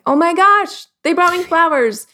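high, loud excited gush; delighted surprise. Acted. Acting delight at surprise flowers.

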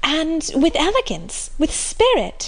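put-on slow, delighted young girl; lightly voiced delighted girl; sparkling eyes. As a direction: ask it thrilled and wide-eyed. Marianne voiced.

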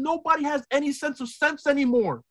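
ordinary talk

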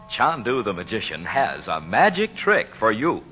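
ordinary talk

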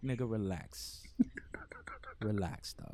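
hushed, dismissive relax; brushing him off under the breath; whispered retort. Retold or imitated. Telling his doubting friend to chill, whispered.

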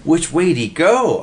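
high excited squeak. A narrator's reading. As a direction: squeak it out in a rush. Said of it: "Oscar squeaked".

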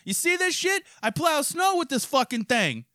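hammed-up neighborly shout-out; mock cheery neighbor. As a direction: holler over the fence like a proud goofball. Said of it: "I'm gonna fucking be like".

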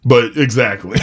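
ordinary delivery

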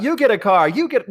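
high, excited giveaway shout; Oprah giveaway impression. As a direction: belt it like a talk show host. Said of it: "I'm not talking about".

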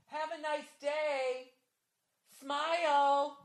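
high, slow sing-song lady; sarcastic chirpy goodbye; the woman taunting him through the window, high and slow; mock sweet taunt.

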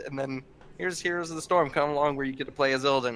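ordinary talk

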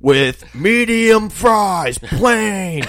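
high, loud and slow drive-thru yell; mock screaming order. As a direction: bellow the order into the speaker. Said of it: "like, screaming it".

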